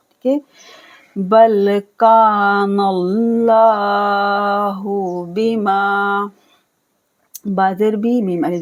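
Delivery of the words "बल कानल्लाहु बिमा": कानल्लाहु with a thick, heavy lam in Allah; slow recitation, heavy lam in अल्लाहु. Shown as a demonstration. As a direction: join nun to lam and say कानल्लाहु with a full, heavy l. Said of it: "लफ्ज़ अल्लाह के लाम को heavy पढ़ना है, मोटा".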